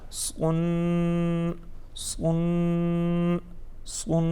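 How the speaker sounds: سن with a heavy, emphatic s, like "swun"; full thick s, long n in سن; thick saad. Shown as a demonstration. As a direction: chant سن softly on one note, s rounded. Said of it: "اس کی نسبت موٹا پڑھتے ہیں".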